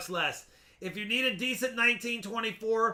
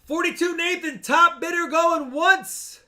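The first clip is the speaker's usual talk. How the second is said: auctioneer; 7 semitones higher, 5 dB louder than usual. Real, not acted. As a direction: call the bid out long. Auction call, slowed.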